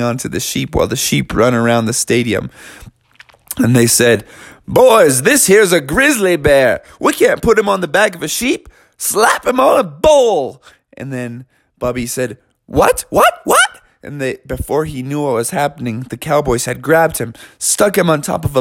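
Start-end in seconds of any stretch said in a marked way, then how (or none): 4.7-10.6 a lightly voiced cowboy crew, rising higher, slowing at the end; gruff cowboys. Cowboys tell Bubby off. 12.7-13.8 each "What?" jumping much higher, rising; a little bear who can't believe it; shocked squeak. Acted. Bubby shocked at bull idea.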